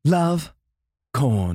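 love lifted high, corn without its R, British; British accent. Shown as a demonstration. Host shows cohost the brand name, British accent. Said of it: "British is".

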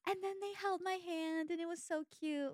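high, dreamy swoon; gushing girlish crush talk. Acted. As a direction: gush like a girl with a crush. Acting out girlish crush gossip.

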